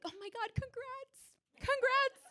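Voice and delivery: imitating gushing colleagues; gushing coworkers. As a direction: squeal it like envious coworkers. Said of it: "people coming up to me being like".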